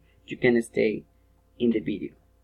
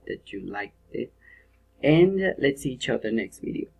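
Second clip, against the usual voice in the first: ordinary talk, nothing marked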